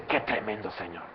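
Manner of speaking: hushed awe. Pretend. Believer marvelling at the Lord, soft and hushed. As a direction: say it almost under your breath. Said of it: "Usted dice".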